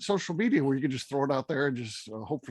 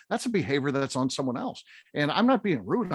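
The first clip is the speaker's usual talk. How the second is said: normal talking voice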